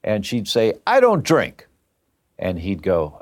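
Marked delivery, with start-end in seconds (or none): 0.9-1.7 high, emphatic retold protest; indignant denial. Aunt answering the doctor's warning about drinking, indignant. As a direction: imitate an aunt firmly objecting.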